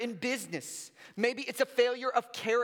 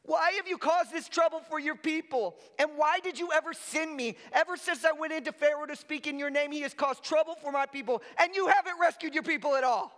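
higher, fast whining complaint; whiny voice; 6 semitones higher, faster than usual. Acted. Moses complaining to the Lord, higher whiny voice. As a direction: whine it like a sulking child. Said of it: "get your best whiny voice on right now".

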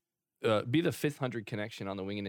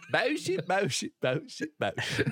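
higher, laughing British parody; mock British impression; 8 semitones higher, slower than usual. Echoing co-host's favorite impression, giggly British mimicry. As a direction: chant it in silly British through laughter. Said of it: "My most favorite impression".

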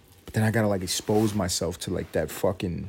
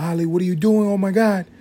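put-on high shocked yell at the kid; scream out; 10 semitones higher, 5 dB louder than usual. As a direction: yell at the toddler in alarm. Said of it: "I'm gonna scream out".

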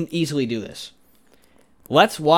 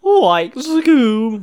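high, loud Shaggy voice; Shaggy impression; 9 semitones higher, 6 dB louder than usual. Friend shows his Shaggy line, high and loud. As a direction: do Shaggy from Scooby-Doo. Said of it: "one line of Shaggy".

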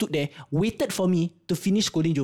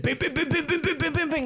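rapid high-pitched bang-bang-bang; imitated drum banging; 10 semitones higher than usual. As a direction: chant it like sticks drumming on a box. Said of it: "started banging the box like a drum".

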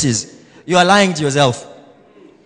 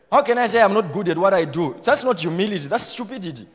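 ordinary talk

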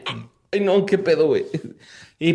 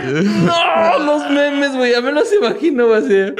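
laughing; 9 semitones higher than usual. Real, not acted. Cracking up over memes.

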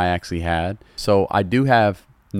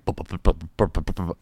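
engine sputter imitation; 2 semitones lower than usual.

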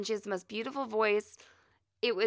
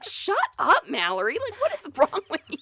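high, loud, laughing at herself; mock self-scolding; 11 semitones higher, 4 dB louder, slower than usual. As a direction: scold yourself in disbelief. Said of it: "I was like".

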